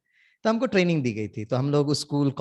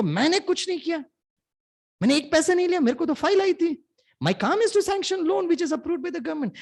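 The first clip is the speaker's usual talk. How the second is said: angry protest; 11 semitones higher than usual. Retold or imitated. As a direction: retell his outraged denial in a raised voice. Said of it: "इतना गुस्सा उनको". (नाराज़ manager की नकल, ऊँची आवाज़ में)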